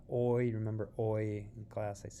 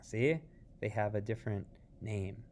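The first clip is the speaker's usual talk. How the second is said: ordinary talk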